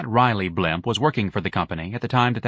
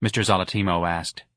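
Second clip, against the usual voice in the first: usual speaking voice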